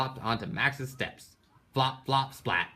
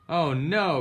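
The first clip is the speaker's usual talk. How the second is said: dismayed cry; 7 semitones higher, 5 dB louder than usual. Acted. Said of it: "cried Froggy".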